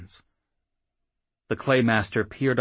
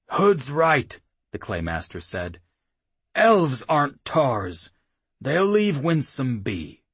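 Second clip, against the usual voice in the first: put-on slow, heavy, commanding old voice; gruff old chief; 6 semitones higher, 4 dB louder than usual. A narrator's reading. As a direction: lay it down slowly like the boss. Claymaster decides, slow.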